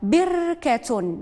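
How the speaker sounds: very slow, stretched out syllable by syllable; drawn-out model word. Shown as a demonstration. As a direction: stretch the word بركة slowly, every sound held. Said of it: "الآن نشوفوا الكلمة الأولى".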